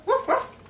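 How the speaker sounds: put-on high playful woof for a toddler; playful dog bark. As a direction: bark like a puppy for a child. Barking for the toddler.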